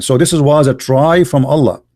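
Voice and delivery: ordinary talk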